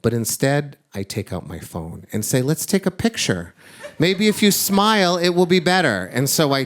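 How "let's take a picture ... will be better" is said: high-pitched, hopeful coaxing. Retold or imitated. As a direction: coax a crying child, pitch lifted. Father coaxing weeping child to smile.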